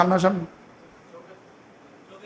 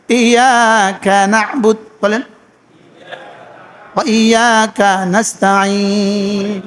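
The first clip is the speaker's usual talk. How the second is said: slow recitation; 2 semitones lower, much slower than usual. Shown as a demonstration. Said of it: "উচিত ছিল এভাবে পড়া".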